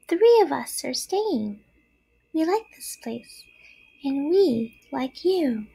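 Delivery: put-on high, gentle baby spider; small voice. A narrator's reading. Spiderling tells Wilbur three are staying, small voice. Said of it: "he heard a small voice".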